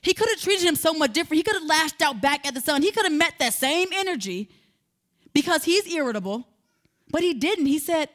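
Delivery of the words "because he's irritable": ordinary talk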